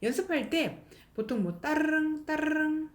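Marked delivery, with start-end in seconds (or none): 1.6-2.9 higher-pitched "따르릉" with a rolled r; rolled-r "따르릉" as a bell imitation; phone-bell trill. Shown as a demonstration. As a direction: ring like a telephone, trilling "따르릉". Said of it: "우리 전화벨 울리는 소리".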